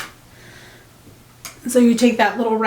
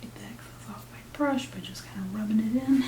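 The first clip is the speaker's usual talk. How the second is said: whispering; 12 dB quieter than usual. Real, not acted. Whispered aside while painting.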